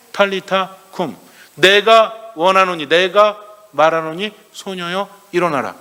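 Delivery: solemn command, drawn out and emphatic; Jesus. Acted. Priest voices Jesus raising the dead girl, slow solemn command. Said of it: "소녀의 손을 잡으면서 뭐라고 하세요".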